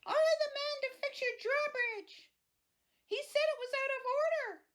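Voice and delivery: high squeaky monster protest. Acted. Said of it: "protested the monster".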